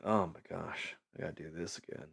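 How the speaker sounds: soft, dragging sigh of routine; tired worker mumbling under his breath; weary mumble. Retold or imitated. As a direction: mutter it like you've done this a thousand times. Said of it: "you're like".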